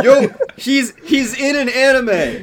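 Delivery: high, slow, amazed; excited. Real, not acted. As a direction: stretch it out in delighted disbelief. Discovers the anime news.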